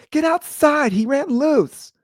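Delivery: very high, loud mock yell; drawn-out, high-pitched call for help. Neighbor calling his wife after the dog ran loose, high mock yell. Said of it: "he yells for his wife".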